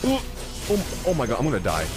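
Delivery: ordinary delivery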